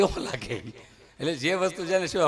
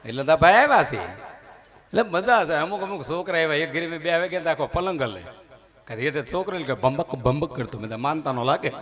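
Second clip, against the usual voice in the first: ordinary talk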